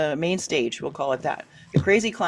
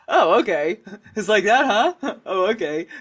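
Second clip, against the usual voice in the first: laughing, louder "Oh, okay, it's like that, huh?"; amused shrug; 4 dB louder than usual. Shown as a demonstration. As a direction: shrug it off with a chuckle. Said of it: "you can put emphasis on that".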